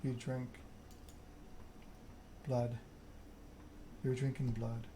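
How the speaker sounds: ordinary delivery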